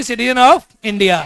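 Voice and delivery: very slow, every word spaced out; slow dictation. Shown as a demonstration. Teacher dictating the full form to students, very slowly.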